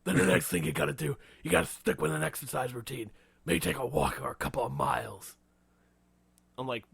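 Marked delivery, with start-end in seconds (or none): 0.0-5.3 forceful put-on hero voice; Batman. Batman laying out an exercise routine, put-on gruffness. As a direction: keep up the mock-grim hero coaching. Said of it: "what's your advice, Batman?".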